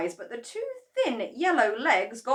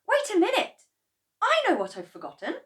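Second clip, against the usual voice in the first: high, quick, startled; sudden realisation; 14 semitones higher, 6 dB louder, faster than usual. A narrator's reading. George suddenly remembering the paint, high and quick. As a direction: snap to a memory.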